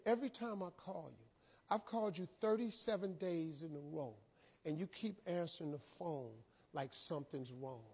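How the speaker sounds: soft, low-key reproach; hushed, serious talking-to; disappointed. Retold or imitated. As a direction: confront him quietly, without anger. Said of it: "I'm really disappointed in you".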